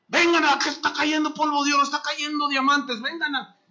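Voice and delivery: a revival host urging people forward; mock revival caller. Mocking gold-dust preacher.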